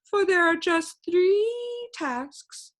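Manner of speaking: slow, smooth friendly stranger; oily polite gentleman voice; amiable gentleman. Acted. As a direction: greet him sweetly, slowly. Gentleman explains tasks.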